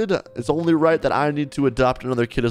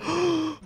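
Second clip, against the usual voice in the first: gasp; 13 semitones higher, 6 dB quieter than usual. Real, not acted. Sees cute kid at daycare.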